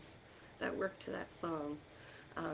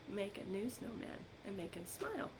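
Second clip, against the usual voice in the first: ordinary delivery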